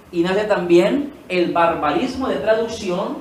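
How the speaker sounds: ordinary delivery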